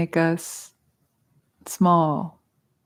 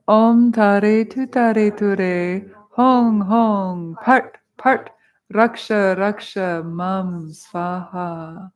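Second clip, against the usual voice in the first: slow, sustained intoning, starting louder; mantra recitation; 4 dB louder, much slower than usual. Shown as a demonstration. Teacher leading the first mantra once more for students, intoned. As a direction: lead the chant with long held syllables. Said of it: "let's say the first mantra together".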